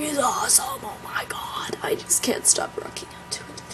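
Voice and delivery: hushed, breathy whispered aside; excited whisper; whispered gushing. Real, not acted. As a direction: drop into a whisper to gush. Whispered gushing aside about the song.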